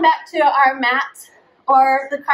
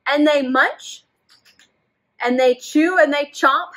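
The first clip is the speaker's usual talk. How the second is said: put-on reading to children; 3 semitones higher, 3 dB louder than usual. A narrator's reading. Teacher reading a story to children. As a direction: draw the kids into the story. Reads picture book aloud.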